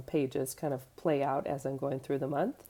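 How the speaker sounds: usual speaking voice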